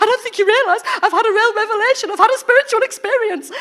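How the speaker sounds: high, loud, laughing insistence; giggling mock indignation; laughing protest. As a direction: say it through laughter, mock earnest. Laughing retelling of her protest.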